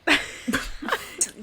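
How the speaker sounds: high, mostly voiceless laughter; real laugh. Real, not acted. Friend laughing after admitting being scammed, real laughter. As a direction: burst out laughing.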